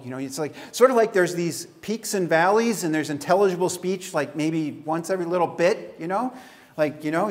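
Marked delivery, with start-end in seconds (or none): none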